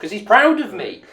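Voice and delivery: high, loud boast of a proud father; proud dad. Acted. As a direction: proclaim it like a beaming dad.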